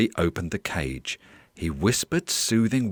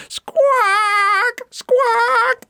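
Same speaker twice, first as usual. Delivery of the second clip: piercing high, loud parrot squawks; shrieking parrot cry, repeated; 7 dB louder than usual. Acted. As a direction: squawk like a noisy bird. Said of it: "Pedro's squawks".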